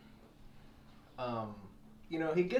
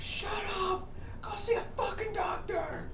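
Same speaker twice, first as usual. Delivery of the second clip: angry yell; slower than usual. Retold or imitated. Exhausted recruits yelling at the snoring bunkmate, furious shout. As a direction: scream it at him across the room.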